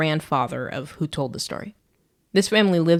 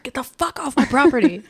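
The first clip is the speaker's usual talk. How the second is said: higher mock outburst; mock angry yell; 8 semitones higher, 4 dB louder than usual. Homeowner to Chert at night, mock angry yell. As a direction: order the intruder off in play outrage.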